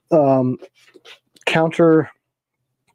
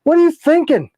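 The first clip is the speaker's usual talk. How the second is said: exasperated rant; 7 semitones higher than usual. Pretend. Ranting at the drone neighbor.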